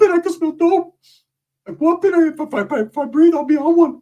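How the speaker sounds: high put-on squeak; squeaky Ricky voice. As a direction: squeak it out, wide-eyed.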